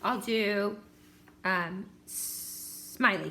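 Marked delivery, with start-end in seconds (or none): none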